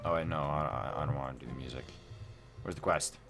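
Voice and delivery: normal talking voice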